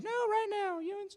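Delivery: very high, firm mom call; insistent mom. Retold or imitated. Mom refusing to wait for the game to end, high and firm. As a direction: insist the kids come now. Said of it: "scream at us from the house".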